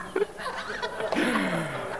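soft trailing laugh; breathy chuckle. Real, not acted. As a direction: laugh it out quietly. Laughs after quoting I'm undone.

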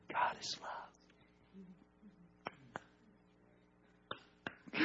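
whispered, hushed. Real, not acted. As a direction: breathe it out as a secret. Hushed climax of sermon.

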